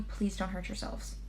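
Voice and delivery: usual speaking voice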